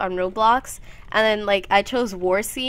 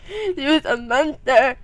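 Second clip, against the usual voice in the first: loud, higher, drawn-out monster imitation; mock monster voice; 5 semitones higher, 5 dB louder, slower than usual. Joking about the sister when little, mock monster voice. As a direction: push it out slowly like a hammed-up monster.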